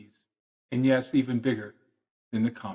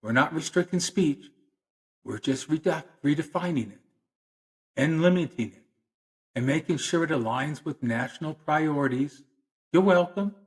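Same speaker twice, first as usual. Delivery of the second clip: higher-pitched, falsely polite official; mock politeness; 4 semitones higher than usual. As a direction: soften the restriction with mock-polite reassurance. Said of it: "is very polite about it".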